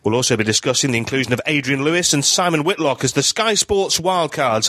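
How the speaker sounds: upbeat radio host opening read; podcast host intro. Acted. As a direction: open the show like a broadcaster. Podcast intro read over music.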